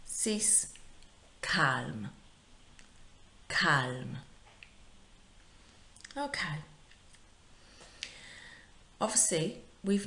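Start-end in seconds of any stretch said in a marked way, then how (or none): 1.4-4.2 "calme" said singly and carefully, final M sounded; model pronunciation. Shown as a demonstration. Teacher modelling "calme" for pupils to repeat, careful pronunciation. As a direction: say "calme" distinctly for copying. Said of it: "repeating after me".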